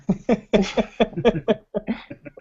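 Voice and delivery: soft chuckle; laughing. Real, not acted. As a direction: laugh quietly at the joke. After the punchline.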